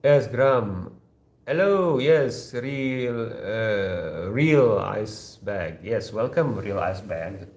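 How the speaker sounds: ordinary talk, nothing marked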